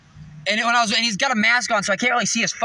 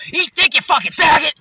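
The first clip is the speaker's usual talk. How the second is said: high, loud hostile comeback; acted angry shout; 7 semitones higher, 6 dB louder than usual. Retelling a comeback to the honking driver, angry shout. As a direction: fire back at full voice. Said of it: "And I go".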